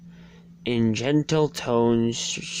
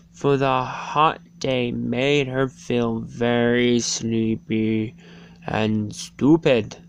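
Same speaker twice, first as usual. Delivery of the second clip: plain reading voice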